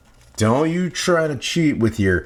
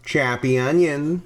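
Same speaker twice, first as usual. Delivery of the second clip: ordinary talk, nothing marked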